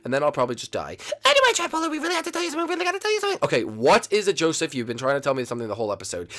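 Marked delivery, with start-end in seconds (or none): 1.2-3.4 very high squeaky voice, pressing to be heard; insistent sidekick. Acted. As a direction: nag insistently in a tiny high voice.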